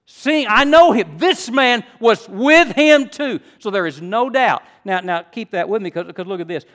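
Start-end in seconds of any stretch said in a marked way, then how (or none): 0.0-3.4 "This man was with him too" high, loud and slow; emphatic reading. Shown as a demonstration. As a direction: punch "I know him" and "with him too", accusing and loud. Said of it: "I read it with emphasis".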